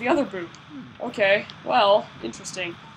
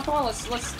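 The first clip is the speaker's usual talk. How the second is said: normal talking voice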